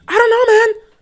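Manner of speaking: high, strained "I don't know, man"; exasperated. Real, not acted. Fan exasperated at the Cowboys blowout. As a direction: throw up your hands in disbelief.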